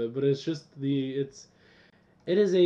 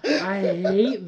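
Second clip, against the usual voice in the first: laughing; 5 semitones higher, 7 dB louder, much slower than usual. Real, not acted. Exaggerated disgust while laughing.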